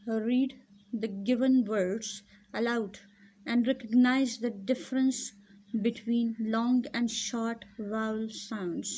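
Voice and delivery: slow, careful reading of the instruction. Shown as a demonstration. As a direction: read the task aloud to the class. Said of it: "reads instruction slowly".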